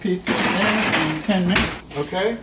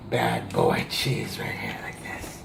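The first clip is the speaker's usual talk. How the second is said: conspiratorial whisper; 4 dB quieter than usual. Acted. Sprinkling cheese, sudden whisper.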